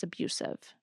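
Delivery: abusive whispered, no voice at all; pointed whisper on abusive. Real, not acted. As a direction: drop to a hushed whisper on abusive. Whispering the key word.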